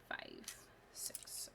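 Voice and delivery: whispered count; whispering. Real, not acted. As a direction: whisper the count under breath. Counting pieces.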